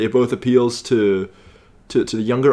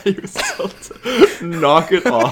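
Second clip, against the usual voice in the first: laughing through the line, slow; laughing repeat; 7 semitones higher, slower than usual. Real, not acted. Co-host repeating the trivia punchline, laughing.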